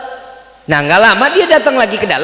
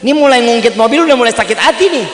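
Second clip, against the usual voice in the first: higher, louder mock irritation; retold annoyance; 4 semitones higher, 5 dB louder than usual. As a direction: replay getting annoyed at being needled. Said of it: "juga kesel gue nih".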